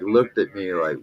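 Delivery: usual speaking voice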